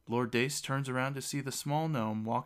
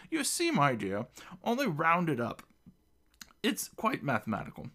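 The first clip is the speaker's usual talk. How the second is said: talking through food, raised pitch; mouth full; 6 semitones higher than usual. A narrator's reading. Gnome explains rounding to the girl, mouth full. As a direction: explain it round a bite of pickle. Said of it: "Talking with his mouth full".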